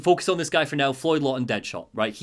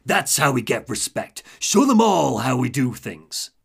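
Two-Face; 3 semitones lower, 4 dB louder than usual. Retold or imitated. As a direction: lean into the sinister second personality. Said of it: "Two-Face responds to him".

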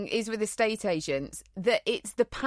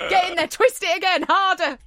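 frustrated yell; 10 semitones higher, 7 dB louder than usual. Retold or imitated. Retold lying in bed at night.

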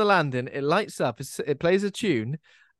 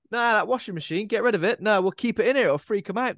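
lightly voiced, higher laid-back bloke; Superhands impression; 7 semitones higher, faster than usual. Superhands explaining his pub plan, higher and laid-back. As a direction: toss it off like a stoner landlord. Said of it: "he says".

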